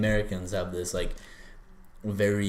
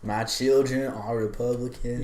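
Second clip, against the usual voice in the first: slow Southern drawl; Southern accent; 3 semitones higher, slower than usual. Shown as a demonstration. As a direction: echo it back in a Southern accent. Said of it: "Can I do an okay Southern accent".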